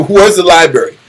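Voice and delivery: normal talking voice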